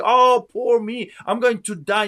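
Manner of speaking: lightly mimicked despairing victim; mock self-pity. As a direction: ham up a poor-me moan. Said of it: "You don't see me crying out and saying".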